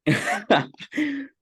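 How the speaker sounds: high, startled laugh at the question; burst of laughter. Real, not acted. Asked if Pep beats Ferguson.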